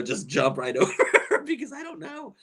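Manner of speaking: usual speaking voice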